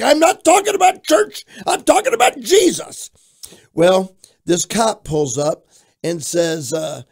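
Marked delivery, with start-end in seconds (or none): 0.0-3.1 high, hollered correction; retold zealous shouting. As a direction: shout them down with fervour. Retold zealous retort at the door.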